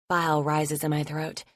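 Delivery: plain reading voice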